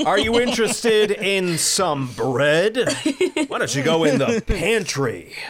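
high, slow muscleman host; hammy, drawn-out offer from a pair of biceps; Kevin, talking muscles. Acted. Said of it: "muscles grew a face and started talking".

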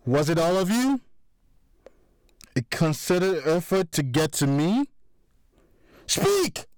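building to a high shouted Speak!; furious demand. A narrator's reading. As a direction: rage rising until he barks the order. Mike demanding answers.

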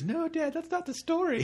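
high-pitched mock kid objecting to dad; protesting child. Kid interrupts dad's wrong telling of the story, high mock protest. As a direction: wail it up high, half-joking.

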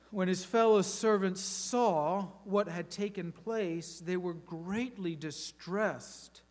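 lively, dramatic Bible reading; scripture reading. Acted. A preacher reading Matthew to his congregation, dramatic. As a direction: read the parable like a story to the church.

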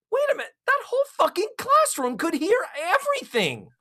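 sudden shocked realization; incredulous. Retold or imitated. Realizing the class heard everything.

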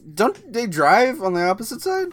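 mocking imitation of a clueless questioner; mimicking co-host. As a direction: parrot a naive question back. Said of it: "She's like".

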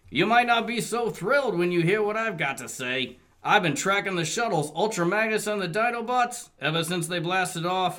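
lightly voiced Wheeljack. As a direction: read it like a worried cartoon bot.

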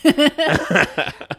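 real laugh; laughter. Real, not acted. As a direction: break into laughter. Laughs at the memory.

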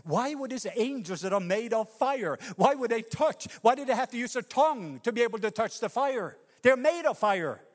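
high, loud preaching build-up; fervent preaching. Acted. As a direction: preach it with rising fire. Preaching fervently about angels' fire.

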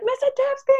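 student's greeting. Retold or imitated. Retelling a tall student calling the teacher, high mock greeting. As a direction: pitch the name way up like a kid calling out. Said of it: "he's like".

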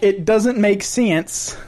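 mock Southern woman, slowing right down on the last phrase; retry the Southern imitation with a twangier, drawn-out "sense"; thick Southern accent. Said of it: "speaks with a thick Southern accent".

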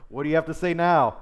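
loud, high jeer; taunting inflection. Acted. Zebul confronting Gal, loud taunting inflection. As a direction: throw it back at him, loud and high. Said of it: "That's my, my inflection".

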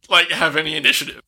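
normal talking voice

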